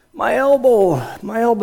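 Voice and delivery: high-pitched imitation of a hurt child; whimpering hurt grandkid. Hurt grandchild to grandpa after a bike pileup, high mock whine. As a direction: play a little one complaining of an injury. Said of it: "they get hurt".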